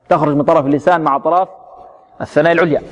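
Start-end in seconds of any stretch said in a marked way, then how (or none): none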